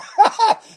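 sudden loud burst of laughter; mocking laugh. Real, not acted. Mocking a picture of Jesus.

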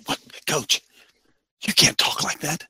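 low, breath-heavy mock objector; hushed objection. Listener scolding coach for his blunt talk, hushed objection. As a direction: half-whisper it like a scandalized listener.

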